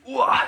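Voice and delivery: sharp high strained yell at lockout; a real grunt of effort as the bar comes up. Real, not acted. Lifter straining at the top of the pull, sharp effort yell.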